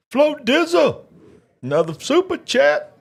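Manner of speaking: high, bright hype for the donor; excited. Real, not acted. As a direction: call the name out like a hype man. Thanking a donor on stream.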